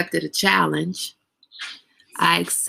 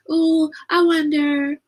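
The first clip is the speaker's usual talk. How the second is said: high, drawn-out wistful wondering; imitated tea timer; 9 semitones higher, slower than usual. Imitating a certain tea timer at the table, playful wistful imitation. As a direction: imitate someone wishing aloud, slowly and higher. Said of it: "She probably didn't sound like this".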